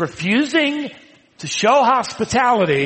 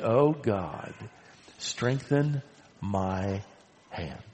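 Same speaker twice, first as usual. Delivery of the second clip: hushed, much softer plea to God; a soft, lower, fading prayer for strength; quiet prayer; 8 semitones lower, 9 dB quieter, much slower than usual. Acted. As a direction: pray it almost under your breath. Nehemiah prays alone.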